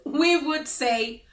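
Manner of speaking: ordinary talk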